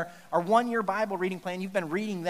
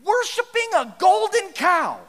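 incredulous; 10 semitones higher, 7 dB louder, slower than usual. Acted. Pastor reveals shocking twist.

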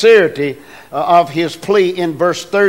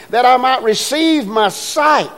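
raised plea; 7 semitones higher than usual. Shown as a demonstration. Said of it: "he just simply asked".

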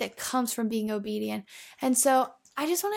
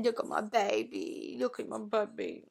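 cooing to the dog; 7 dB quieter than usual. Real, not acted. Soft, fond coo to her dog about her dog. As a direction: croon over a beloved pet.

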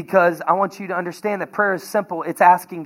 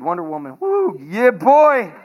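playful brag; 5 semitones higher, 3 dB louder than usual. Real, not acted. Bragging about his wife.

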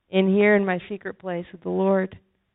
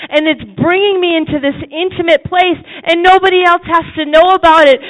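much higher, much louder impassioned preaching with tearful cracks; fervent exhortation, loud and higher, breaking with emotion; preaching at full force; 7 semitones higher, 8 dB louder than usual. Acted. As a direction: cry out the climax to the congregation. Climactic preaching with rising passion.

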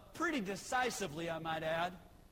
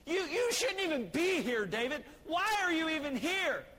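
higher and louder, scolding; scolding older brother; 6 semitones higher, 4 dB louder than usual. Retold or imitated. Preacher as Eliab scolding David, raised voice.

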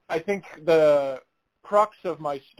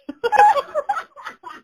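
loud, high burst of real laughter; cracking up hard at the punchline; helpless high laugh; big laugh; 6 dB louder than usual. Real, not acted. Laughing at daughter's request.

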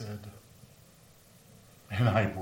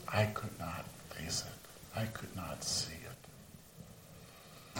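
grief-stricken whisper; 10 dB quieter, much slower than usual. Acted. Peter overcome by grief.

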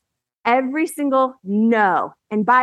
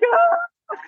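a very high, broken little oh through tears; tearful oh. Retold or imitated. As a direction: squeak it out as the sobbing starts. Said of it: "And I was, like, sobbing".

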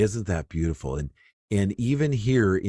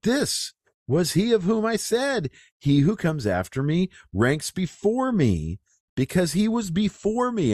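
much higher, proclaiming as John; John crying out; 12 semitones higher than usual. Acted. Preacher voices John the Baptist proclaiming, raised and ringing. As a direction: cry it out as the prophet.

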